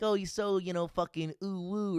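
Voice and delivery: ordinary delivery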